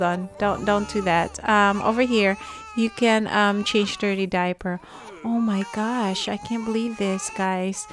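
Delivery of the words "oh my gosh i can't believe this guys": ordinary talk, nothing marked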